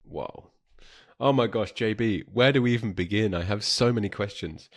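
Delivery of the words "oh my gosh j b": ordinary talk, nothing marked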